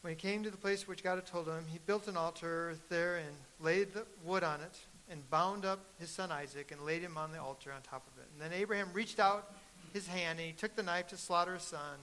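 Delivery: loud, emphatic Bible reading from the pulpit; reading the story to the congregation like a proclamation; scripture reading. Acted. Preacher reads Abraham's story to the congregation, loud and emphatic.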